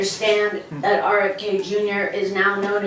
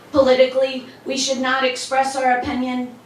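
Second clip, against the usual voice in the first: ordinary talk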